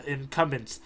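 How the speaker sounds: usual speaking voice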